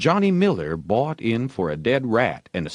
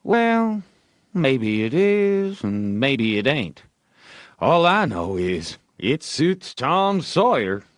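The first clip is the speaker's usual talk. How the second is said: offhand and slow, a little higher; lightly voiced nonchalant Tom; careless; 5 semitones higher than usual. A narrator's reading. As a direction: brush it off casually. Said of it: "answered carelessly".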